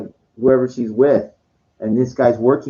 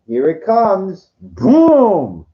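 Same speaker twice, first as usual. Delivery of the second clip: mock dramatic build; 5 semitones higher, 4 dB louder, much slower than usual. Mimicking the night's letdown.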